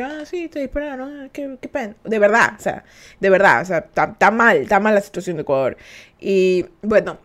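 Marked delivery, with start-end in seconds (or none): 0.0-1.9 much softer, offhand shrug of a voice; nonchalant police. Retold or imitated. Calm police officers brushing off the shooting, soft indifferent tone. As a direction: act like it's nothing. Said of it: "Tranquilos los manes".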